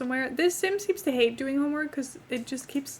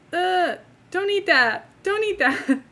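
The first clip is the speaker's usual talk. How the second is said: higher, louder disgusted outburst; 6 semitones higher, 6 dB louder, slower than usual. Real, not acted. Telling a game character not to eat, disgusted. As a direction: recoil with a drawn-out ugh. Disgusted at sim eating food.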